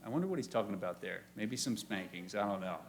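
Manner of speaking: soft, quick joking mutter; quiet aside. Real, not acted. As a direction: throw it away under your breath. Joking aside after quote.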